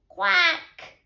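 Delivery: duck's quack. A narrator's reading. Duck answering the ladybird, loud quack. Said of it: "said the duck".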